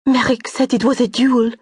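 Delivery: high, loud distressed answer; upset. Acted. Frightened companion explaining the wound, distressed. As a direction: blurt it out in alarm.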